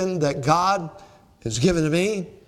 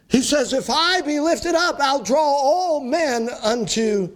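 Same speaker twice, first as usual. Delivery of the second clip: higher, building preacher's cry, full force; rising preaching; 7 semitones higher than usual. Acted. Preacher urging the congregation toward the cross, rising high. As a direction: lift it up like a revival climax.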